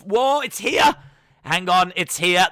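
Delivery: high, loud sudden excitement; excited. Real, not acted. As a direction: burst out with excitement. Team news suddenly arrives.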